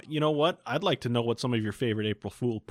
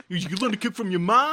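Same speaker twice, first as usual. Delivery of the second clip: a lightly voiced East Coast accent, higher; a loose East Coast twist on the chef; 7 semitones higher, 3 dB louder than usual. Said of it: "Suddenly he's East Coast".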